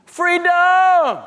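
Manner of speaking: high, loud cry of liberation; jubilant shout. Acted. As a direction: yell it out like a teen set loose. Teen celebrates getting a driver's license.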